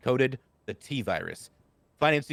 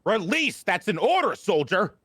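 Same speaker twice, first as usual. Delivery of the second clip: higher, louder barked military order; commanding shout; 8 semitones higher, 5 dB louder than usual. Acted. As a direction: bark it at a soldier who won't obey. Officer barking an order at soldier.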